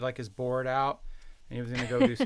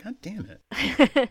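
slow, high, louder mock curse; frustrated dad; 9 semitones higher, 6 dB louder, much slower than usual. A father on the clifftop curses as his daughter escapes.

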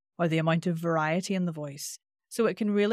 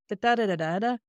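tune on da-da; 3 semitones higher, faster than usual. Shown as a demonstration. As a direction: beat out the lilt on "da" alone. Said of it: "It's the same thing".

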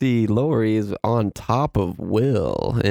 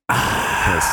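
mock gasp; 5 semitones lower than usual. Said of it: "and then he's like".

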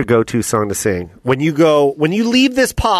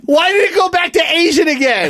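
laughing shout; 13 semitones higher than usual. Real, not acted. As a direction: yell through laughter. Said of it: "laughing shout".

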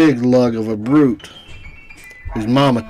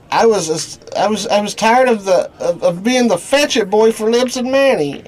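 nervous stammering; 8 semitones higher than usual. Acted.

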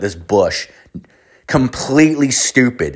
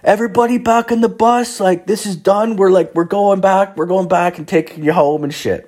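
tour guide calling out; 10 semitones higher than usual. Retold or imitated. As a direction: round everybody up onto the bus. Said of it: "So the guy's like".

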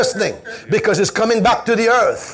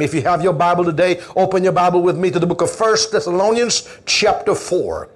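usual speaking voice

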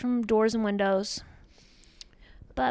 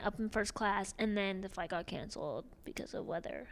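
quiet; 11 dB quieter than usual. Acted. Drops to whisper.

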